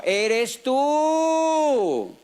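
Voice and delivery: "eres tú" pitched high, with a very long "tú"; driving "tú" home, long and high; emphatic stretched words. Real, not acted. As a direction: hold the "tú" high and let it fall at the end. Driving home personal responsibility.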